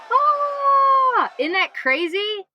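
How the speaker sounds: high, loud, squeaky mock exclamation that slows at the end; Abby. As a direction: imitate a friend's amazed squeal. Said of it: "Abby will probably throw in".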